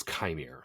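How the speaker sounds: "Chimere" said KY-meer, first part rhyming with sky; accepted pronunciation. Shown as a demonstration. Host settles on the name from the show, "Chimere" as KY-meer. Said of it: "I believe it's".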